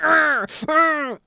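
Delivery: high, sudden mad yelp; two loud high "arr" outbursts; mock crazed growl-cry. Streamer answering "are you good?", mock crazed cry.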